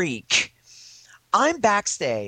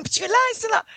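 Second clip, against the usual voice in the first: high-pitched flash noise and shouts; imitated press frenzy; faster than usual. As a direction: mimic screaming photographers and cameras. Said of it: "flash bulbs and scri".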